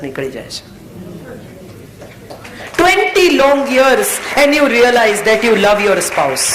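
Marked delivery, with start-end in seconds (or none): 2.8-6.5 slow emphatic. Acted. Sermon point, slowed English emphasis.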